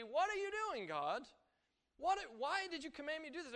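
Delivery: high, pleading complaint to God; exasperated believer questioning God; doubting believer. Retold or imitated. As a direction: whine it up at God like a confused believer. Preacher voicing doubting believer's complaint.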